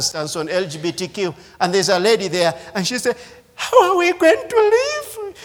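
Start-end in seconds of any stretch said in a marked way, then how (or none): 3.5-5.3 high, imitating a crying woman; mock crying plea. Preacher imitating a crying woman on TV, high and tearful. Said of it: "And she's crying".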